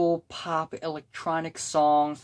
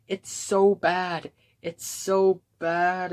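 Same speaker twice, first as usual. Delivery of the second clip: exasperated groan; 4 semitones higher, slower than usual. Real, not acted. Exasperated verdict on the hook.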